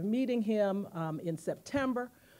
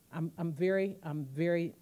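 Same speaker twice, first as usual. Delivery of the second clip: normal talking voice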